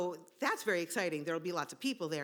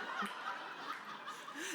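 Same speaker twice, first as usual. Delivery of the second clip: breathy laugh; 7 semitones higher, 10 dB quieter than usual. Real, not acted. Laughs mid-story.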